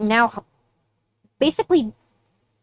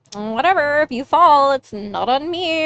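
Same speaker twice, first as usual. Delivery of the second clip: dismissive shrug; 6 semitones higher, 3 dB louder than usual. Acted. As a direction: shrug it off, dragging the words out. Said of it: "Sugar just shrugs and goes".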